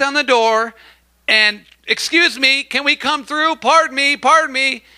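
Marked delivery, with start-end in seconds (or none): 1.9-4.8 high, politely squeezing through a crowd; polite pushing through. Retold or imitated. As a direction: excuse yourself past people, pitched up. People pushing through crowded room.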